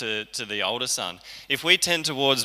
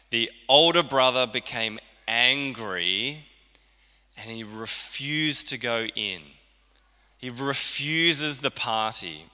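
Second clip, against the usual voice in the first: ordinary delivery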